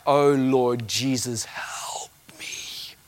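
breathless whispered prayer for help; whispered plea. Retold or imitated. Preacher recalling his nervous plea, whispered. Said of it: "my little mega church brain was like".

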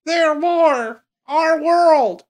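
high squeaky voice. Acted. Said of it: "just gonna do it in different voices".

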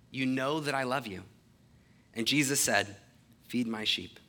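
measured, solemn Bible reading; a steady public reading of scripture. Acted. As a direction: read the passage clearly to the congregation. Reading continues.

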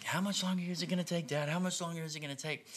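put-on high, fast whiny kid voice; a son nagging his dad to hurry up; impatient kid.

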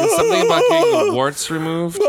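high eerie killer voice; creepy high voice. Retold or imitated. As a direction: squeal it out like a deranged caller. Mimicking the killer's voice.

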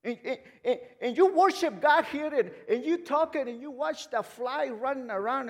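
loud, high-pitched pulpit scolding; fired-up preaching. Real, not acted. Preacher scolding distracted worshippers, loud and high. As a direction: preach it loudly, pushing each phrase.

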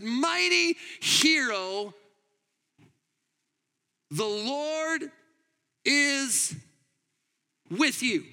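angel's proclamation. Acted. The angel greeting Gideon with a slow grand call. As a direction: announce it like a heavenly herald.

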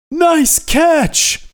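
loud, high-pitched battle yell; screamed movie line. Retold or imitated. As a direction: yell it like an action character, pitch way up. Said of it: "and he screams".

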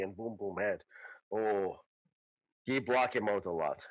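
lightly voiced simple character, low; simple Boom Boom character. Boom Boom explaining how he blocks out the puppet, low voice. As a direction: sink into low, broken speech.

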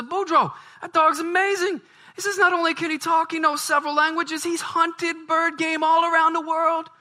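high, loud, breathless amazement. Acted. [name] rushes to tell [name] about the dog, excited.

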